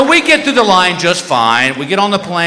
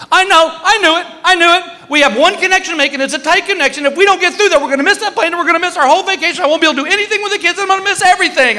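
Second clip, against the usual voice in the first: high, whiny, frantic wife voice; shrill-pitched hammed-up wife in a panic; panicky wife; 12 semitones higher, much faster than usual. Wife panics in security line.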